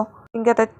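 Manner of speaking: plain reading voice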